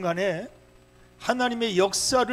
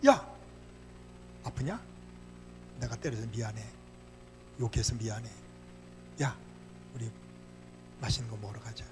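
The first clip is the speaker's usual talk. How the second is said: low, soft, softened father; tender apology; 7 semitones lower, 10 dB quieter than usual. Acted. Father apologizes to the child he punished, tender and quiet. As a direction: make up gently, with pauses between phrases.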